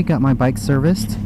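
ordinary delivery

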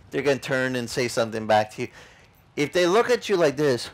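ordinary talk

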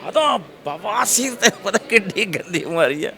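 high, drawn-out alarm over the illness; acted high-pitched outburst; loud-mouthed son. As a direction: announce it loudly despite being hushed. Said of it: "ਉੱਚੀ ਤਰ੍ਹਾਂ ਬੋਲਦਾ".

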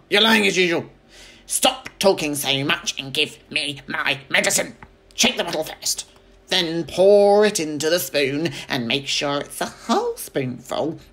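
put-on loud, bossy old woman; cranky grandma. A narrator's reading. Grandma bossing George about her medicine. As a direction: order the boy about.

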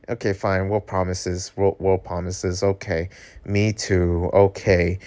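plain reading voice

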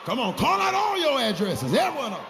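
loud, high shouted preaching; roaring call-and-response exhortation; full-force preaching. Real, not acted. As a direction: whip the congregation into shouting back.

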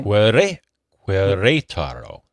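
"Querétaro" slowly, stressed accented E as "ray"; corrected pronunciation. Shown as a demonstration. Co-host shows host how to say the city, slow careful "Querétaro". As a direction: sound out "Querétaro" as kweh-RAY-tah-ro, slowly.

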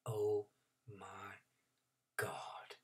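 horrified whisper. Acted. Horrified realization.

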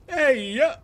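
high, loud cheer; excited shout. Real, not acted. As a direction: whoop it out. Cheering in game.

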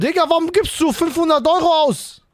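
high, loud indignant shouting; outraged. Real, not acted. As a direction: call out the wasted money. Friend overspends money.

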